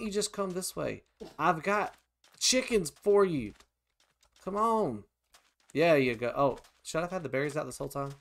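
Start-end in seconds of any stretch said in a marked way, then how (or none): none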